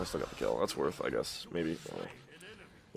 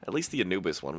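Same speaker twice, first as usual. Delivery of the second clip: ordinary delivery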